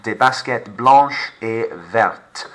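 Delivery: "des baskets blanches et vertes" slowly with every S silent; slow, drop the plural s. Shown as a demonstration. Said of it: "I'm not saying my S".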